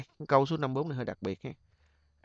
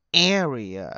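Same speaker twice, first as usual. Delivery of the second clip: Area with long first syllable, light tail; stress on first syllable. Shown as a demonstration. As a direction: stretch the A of Area, glide over the rest. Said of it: "còn cái kia chúng ta đọc nhẹ lướt". (nhấn âm đầu Area, lướt nhẹ phần sau)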